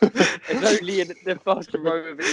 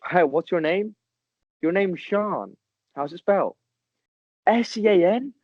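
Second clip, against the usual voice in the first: imitating an American TikToker, rising higher at the insult; American guy; 5 semitones higher than usual. Retelling the TikTok guy mocking the name Sean, light American voice. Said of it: "this American guy, who's like".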